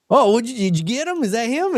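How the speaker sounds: high-pitched mock rancher questioning; excited rancher. Rancher asking if they got the bad guy, high excited impression. Imitating rancher seeing the suspect.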